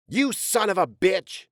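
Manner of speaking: spat insult. Acted. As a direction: snarl it at your brother. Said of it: "Alvin spat".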